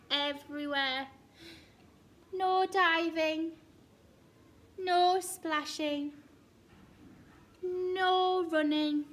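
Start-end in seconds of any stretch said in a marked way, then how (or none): 2.3-9.1 high, loud, slow list of rules; drawn-out officious orders; bossy proclamation. A narrator's reading. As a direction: announce each ban with great importance. Reading out Duck's bossy signs.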